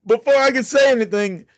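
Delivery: high, laughing through the words. Real, not acted. Telling the podcast host the Shelton story, laughing. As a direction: giggle out the setup.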